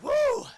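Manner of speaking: high loud whoop; hammed-up whoop of relief; relieved whoop. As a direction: let out relief. Relief after axe lowered.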